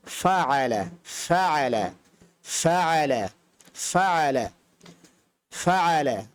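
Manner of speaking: ফাআলা repeated for the learner, clear আইন in the middle; repetition drill. Shown as a demonstration. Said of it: "এই শব্দটা বিশ বার ... বলে নিতে পারেন".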